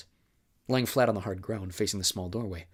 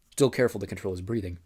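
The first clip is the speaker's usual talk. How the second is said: ordinary delivery, nothing marked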